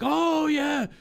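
mock an overeager Japanese yes-sayer, yelling each yeah; a run of high shouted yeahs, hammed up; eager yes-man. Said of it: "And they're like".

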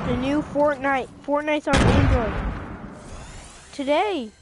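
loud, thrilled news; excited announcement. Real, not acted. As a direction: blurt out the big news.

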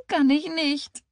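high, tearful denial; close to sobbing. A narrator's reading. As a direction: wail it back helplessly. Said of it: "Ihr Kinn zitterte unaufhörlich". (weinerlich, sehr hoch)

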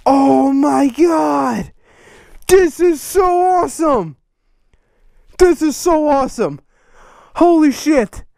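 overwhelmed, shouting with joy; real hype. Real, not acted. Unboxing fan's flag of his logo, genuinely thrilled shouts.

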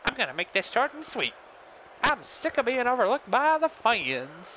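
very high-pitched put-on wrestler voice; cartoonish high voice tried out for a character; trial character voice. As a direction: squeak it out in a silly high voice. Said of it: "figure out a voice".

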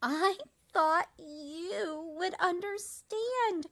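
a wounded little cricket; hurt. A narrator's reading. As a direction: let the hurt show, slowly. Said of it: "the cricket said".

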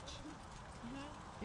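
ordinary delivery

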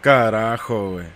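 low, muttered swear; cursing under breath. Real, not acted. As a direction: grumble the curse to yourself. Swears after failing again.

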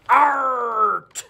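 laughing pirate arr. Acted. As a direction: growl "Art" like a pirate. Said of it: "my pirate voice".

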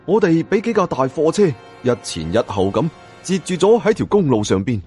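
plain reading voice

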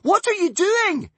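high, loud, slow alarm. A narrator's reading. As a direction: demand an answer in panic. Wish voiced.

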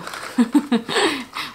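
short real laugh; amused laugh. Real, not acted. Vlogger amused by a grumpy calendar message, real laugh. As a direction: laugh at what was just read.

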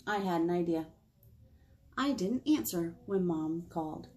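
plain reading voice

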